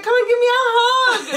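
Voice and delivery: high, loud imitated demand for a hug; loud calling out. Imitating friend demanding hug while undressed, loud calling out. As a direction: belt it out high, mock gushing.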